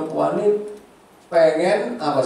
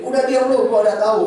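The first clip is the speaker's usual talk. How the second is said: much higher, louder dismissive snap; rude husband snapping; 10 semitones higher, 7 dB louder, faster than usual. Retold or imitated. Suami kasar menyuruh istrinya diam, dismissive snap. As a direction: bark it like a husband who won't listen.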